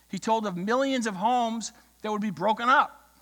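ordinary talk, nothing marked

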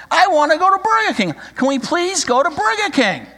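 high, loud whiny plea; pleading child. Acted. Tommy begs his mother, high and loud. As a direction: beg like a desperate little boy. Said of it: "Tommy pleaded".

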